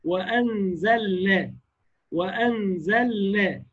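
وأنزلنا slow, the lam held and clearly sounded before the nun; slow careful وأنزلنا, resting the tongue on the lam; clear lam demo. Shown as a demonstration. Teacher shows the correct clear lam slowly. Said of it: "لا، وأنزلنا".

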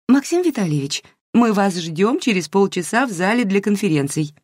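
put-on high chirpy girlish voice; cartoon princess. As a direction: chirp it like a sugary young organizer. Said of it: "прощебетала в трубку девушка-организатор".

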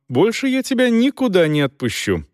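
usual speaking voice

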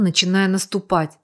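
plain reading voice

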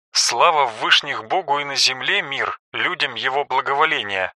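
soft footnote reading; quiet aside. A narrator's reading. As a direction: drop the voice for a footnote. Author's footnotes read aside.

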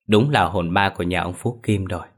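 plain reading voice

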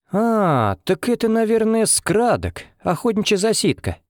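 light up with a sudden idea; beaming. A narrator's reading. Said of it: "просиял Митя".

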